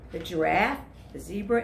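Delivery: plain reading voice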